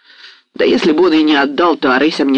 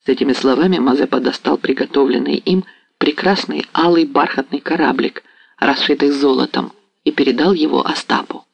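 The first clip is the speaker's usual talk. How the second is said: ordinary delivery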